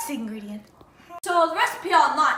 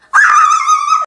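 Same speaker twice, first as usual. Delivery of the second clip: very high, loud shriek; excited scream; 18 dB louder than usual. Real, not acted. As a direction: let out a big excited scream. Excited yell after counting.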